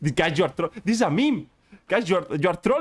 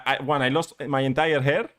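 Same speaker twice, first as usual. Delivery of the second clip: ordinary delivery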